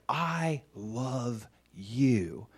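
very slow, softened declaration of love; softly drawn-out, heartfelt retold words; tender affirmation. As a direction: stretch each word, a father's reassurance. Father God tenderly affirms him.

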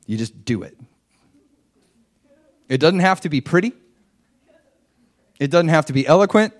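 ordinary delivery

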